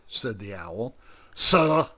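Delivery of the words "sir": higher, louder sudden outburst; defending his father, shout. Acted. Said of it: "shouted John Quincy suddenly".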